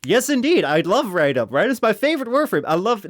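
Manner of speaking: high sing-song flattery; butter up the viewer; fawning youtuber. Pretend. Video host flattering every viewer, high sing-song.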